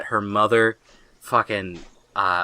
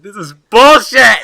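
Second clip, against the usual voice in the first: louder, slow, higher "This is bullshit!"; mock outraged yell; 10 semitones higher, 11 dB louder, slower than usual. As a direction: bellow it like a betrayed fan. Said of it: "I was like".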